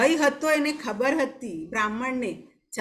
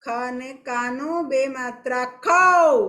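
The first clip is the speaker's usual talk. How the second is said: ખ ને કાનો બે માત્રા ખઉ, slow and a bit louder; slow dictation; 5 dB louder, slower than usual. Shown as a demonstration. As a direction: dictate it slowly and clearly. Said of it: "બોલી બોલીને લખો ધીરે ધીરે". (બાળકોને ધીમે અને મોટેથી લખાવતી શિક્ષિકા)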